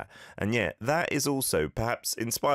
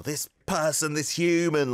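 exasperated; 8 semitones higher, 3 dB louder than usual. Shown as a demonstration. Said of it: "they're just being ridiculous".

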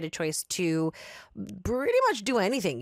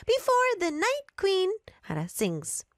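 clerk voice; 11 semitones higher than usual. Acted. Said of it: "finishing out loud".

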